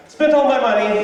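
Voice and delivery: high, loud carefree confession; kid at Jamba Juice. Retold or imitated. Teen kid back from Jamba Juice, high loud cheerful blurt. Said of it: "is like".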